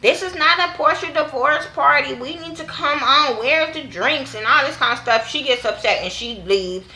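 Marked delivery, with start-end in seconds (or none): 0.0-4.3 high, bossy party-girl mock voice; mock Kenya. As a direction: act out Kenya taking over the party. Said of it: "talking about".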